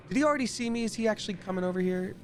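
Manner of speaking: high, startled questions; panicked at being spotted; alarmed. Real, not acted. Enemy spots her in stealth.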